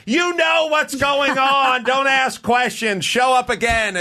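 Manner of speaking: high, loud, slow yelled scolding; teacher yelling. Retold or imitated. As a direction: shout the order from the back of the room. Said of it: "And she'd yell".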